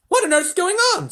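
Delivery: high, loud presidential outburst; alarmed shout. A narrator's reading. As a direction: demand an answer, very high and loud. President hears the screams.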